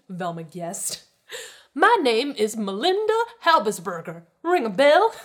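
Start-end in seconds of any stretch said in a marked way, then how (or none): 1.7-5.1 loud, high, self-important announcement; grand, pitched-up snob; haughty rich woman. A narrator's reading. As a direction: announce yourself as someone who must be recognized. Haughty woman announces her name.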